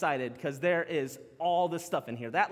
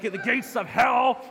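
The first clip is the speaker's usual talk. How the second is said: football hype; 4 semitones higher, 5 dB louder, slower than usual. Acted. Hyping the verse like football.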